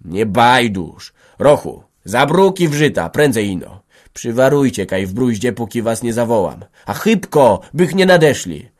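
loud, insistent commands; urgent orders. A narrator's reading. Antek urging Rocho to hide, urgent commands. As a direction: order him off before they arrive.

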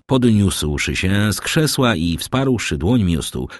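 ordinary delivery

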